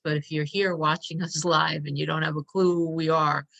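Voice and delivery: ordinary talk, nothing marked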